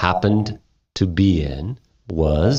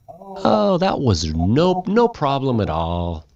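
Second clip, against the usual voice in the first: woman's breezy voice; 5 semitones higher than usual. Acted.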